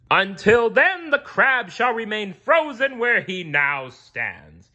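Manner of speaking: high, slow booming king; royal decree. A narrator's reading. Neptune freezing Krabs, slow booming decree. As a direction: cast the spell. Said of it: "lifted his trident".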